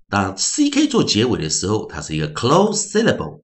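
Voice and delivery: ordinary talk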